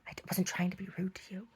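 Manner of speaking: hushed, near-whispered imitation of a hurt visitor; whispery retold excuse; meek mock apology. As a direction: back down in a tiny, soft voice.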